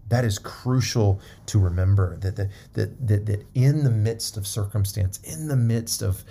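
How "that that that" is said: usual speaking voice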